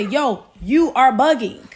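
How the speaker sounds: mock exasperated scolding. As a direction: lay down each word in exasperation, imitating him. Acted scolding from the man.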